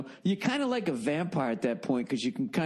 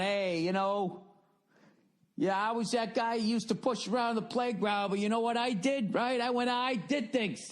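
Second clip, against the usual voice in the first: much higher, boastful ex-bully bragging; a hammed-up playground bully showing off, higher pitched; smug cigar guy; 10 semitones higher than usual.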